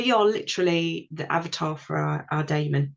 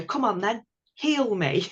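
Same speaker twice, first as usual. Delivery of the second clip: higher-pitched plea to the woods; mock demand to be healed; demanding self-quote; 4 semitones higher than usual. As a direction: act out asking the woods for a cure. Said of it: "go into the woods and go".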